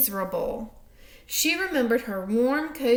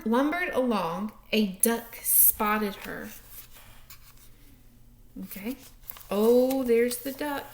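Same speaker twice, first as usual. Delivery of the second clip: ordinary delivery, nothing marked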